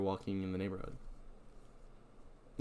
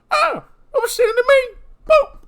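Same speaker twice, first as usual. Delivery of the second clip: startled yell; 14 dB louder than usual. Acted. Ronnie startled, yells at John.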